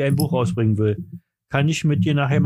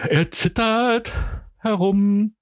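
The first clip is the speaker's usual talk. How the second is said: put-on trembling old man; 7 semitones higher, much slower than usual. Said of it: "ich bin so ein alter Mann, der immer so zittert".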